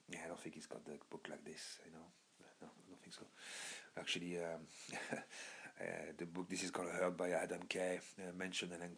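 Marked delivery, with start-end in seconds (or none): 0.1-3.3 whispered aside. Real, not acted. Aside about Alan Carr.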